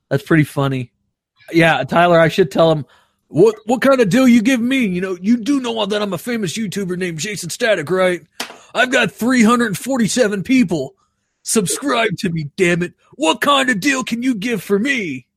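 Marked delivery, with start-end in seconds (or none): 3.3-15.3 high-pitched mock self-importance; flexing YouTuber. Small YouTuber bragging to a telemarketer, high mock indignation. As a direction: pull rank like a big celebrity, as a joke. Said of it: "just start flexing on people".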